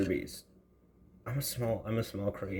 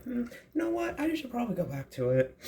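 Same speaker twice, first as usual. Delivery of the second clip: high thinking voice; 13 semitones higher than usual. Retold or imitated. Inner thought voiced as quote.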